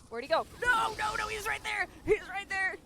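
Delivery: high shouted alert; excited callout. Real, not acted. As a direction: point out the enemy, yelling. Spotting enemy in game.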